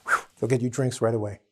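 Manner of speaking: normal talking voice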